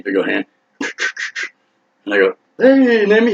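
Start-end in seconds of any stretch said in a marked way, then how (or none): 2.6-3.3 nervous greeting. Retold or imitated. Startled greeting at car window.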